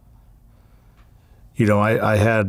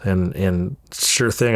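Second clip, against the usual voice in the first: ordinary delivery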